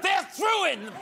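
high, hammed-up indignation; mock outrage. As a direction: build to a high mock cry. Mock outrage over the hat.

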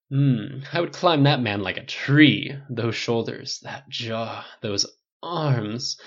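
flamboyant gushing salon stylist; campy stylist. Acted. Stylist gushing to Tony about his captain, campy. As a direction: swoon over the man out loud.

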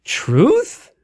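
TRUTH drawn out, rising high; TRUTH with a steep questioning swoop; questioning reply. Acted. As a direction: ask TRUTH as a literal stand-in for really. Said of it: "we wouldn't say it like that".

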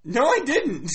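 high, loud objection; loud protest. Real, not acted. Player denying the donkey story, loudly protesting. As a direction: cry out in denial.

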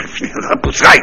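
cursing through a breathy laugh; half laughing, half shooing it away; laughing mock curse. Comic telling the monkey to get off, laughing.

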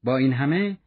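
usual speaking voice

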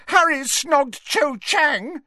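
slow, high shout near tears; tearful shout. Acted. Ginny exposing Harry to her brother, shouting near tears. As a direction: fling it out, close to tears. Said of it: "shouted Ginny, who sounded close to tears".

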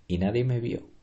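usual speaking voice

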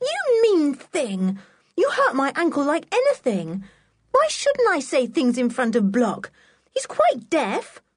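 put-on high, cross child's protest; stung and indignant, pitched well up; indignant scolding. A narrator's reading. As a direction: scold them in a high, aggrieved voice. Anne scolds Sooty over hurt ankle.

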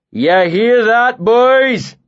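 northern bully shouting over his shoulder; shouted to the room. Acted. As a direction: call the whole bar to laugh along. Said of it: "he shouted over his shoulder".

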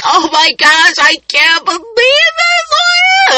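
very high wail, slowing and stretching out; hammed-up sobby whine climbing sky-high; mock talking through tears. Woman crying to mom over a gray hair, mock wail. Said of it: "I'm talking and crying".

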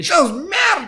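mock insulting shout. Co-hosts pretend to scold listeners who like paella, mock shouting. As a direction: hurl playful abuse at the audience.

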